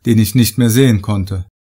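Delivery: plain reading voice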